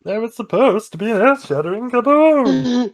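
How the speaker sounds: high squeaky cartoon alien voice; Marvin the Martian. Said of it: "that was better".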